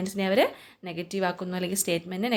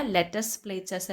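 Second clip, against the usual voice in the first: each word drawn out for the class; slow dictation; much slower than usual. Shown as a demonstration. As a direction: read it out word by word, very slowly. Teacher dictates example slowly. (പതുക്കെ വാക്കുകൾ വേർതിരിച്ച്)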